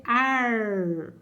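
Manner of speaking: AR drawn out with a retroflex R; caipira R. Shown as a demonstration. Teacher reading vowel before R, caipira R in "ar". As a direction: stretch "ar" into a curled-tongue "arrr". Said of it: "que eu chamo de R caipira".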